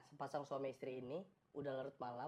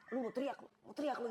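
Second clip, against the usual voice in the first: higher-voiced mock threat; threatening robber; 7 semitones higher than usual. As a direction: play a robber warning a hostage. Maling mengancam istri. (ancaman maling pura-pura)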